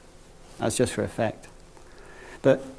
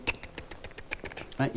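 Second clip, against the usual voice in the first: whispered clock ticking, one tick after another; soft unvoiced tick, tick, tick like a clock; clock tick imitation; 17 dB quieter than usual. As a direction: tick it out softly like a grandfather clock. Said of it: "you could hear the tick".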